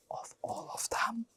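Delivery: a breathy whispered wish. Real, not acted. Wishing for the whole set, a soft whisper.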